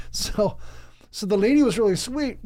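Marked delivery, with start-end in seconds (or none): none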